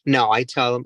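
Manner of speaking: usual speaking voice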